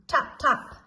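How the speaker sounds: ordinary delivery, nothing marked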